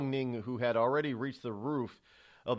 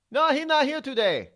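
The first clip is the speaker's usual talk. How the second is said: high, loud mock Chinese accent; a put-on Chinese accent, much higher and louder; bad Chinese accent; 11 semitones higher, 6 dB louder than usual. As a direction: answer the phone in a hammed-up Chinese accent. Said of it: "it's my very bad Chinese accent".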